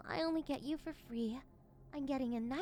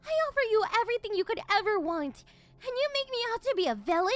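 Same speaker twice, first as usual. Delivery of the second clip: sudden furious shout, higher and fast; unhinged villain; 5 semitones higher, 8 dB louder than usual. Acted. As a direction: snap from sweet to rage, yelling. Mistress furious at struggling pet.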